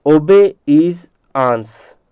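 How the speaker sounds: "obey is आंस" very slowly, part by part; broken into parts. Shown as a demonstration. Teacher splitting a word for spelling, broken into parts. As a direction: split "obey is आंस" into three stretched, separate words. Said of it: "इसलिए इसको तोड़ करके बता रहा हूँ".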